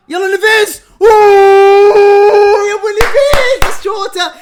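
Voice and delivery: very high, loud cheering shout; excited shout. Real, not acted. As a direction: scream for the shot. Cheering a shot live.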